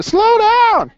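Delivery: high, loud demo shout of slow down; yell. Pretend. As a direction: yell it out like a student begging the teacher. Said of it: "Do I need to slow down?".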